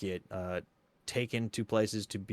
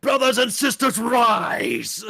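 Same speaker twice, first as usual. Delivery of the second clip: laughing high rallying shout; fiery revolutionary speech; 12 semitones higher, 7 dB louder, much slower than usual. Pretend. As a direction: call the crowd to rise, hammed up. Mock rally cry continues.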